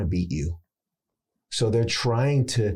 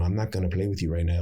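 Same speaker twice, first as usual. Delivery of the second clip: low, serious Spanish accent; 4 semitones lower, faster than usual. Shown as a demonstration. Actor demonstrates a crime drama role, low serious Spanish accent. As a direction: warn someone, Spanish accent kept low. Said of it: "keep it very serious".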